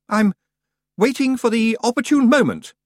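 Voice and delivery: high, loud lofty tone; sniffy and superior; haughty. Acted. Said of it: "said Roland haughtily".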